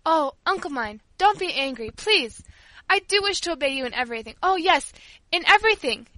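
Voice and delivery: earnest, insistent appeal; impassioned pleading. A narrator's reading. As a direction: plead fervently with an angry uncle. Paula pleads to be allowed prayer.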